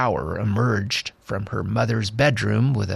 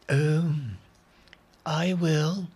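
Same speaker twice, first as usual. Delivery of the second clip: tired doctor voice; 5 semitones higher, 3 dB quieter, much slower than usual. Acted. Tired doctor answers hesitantly.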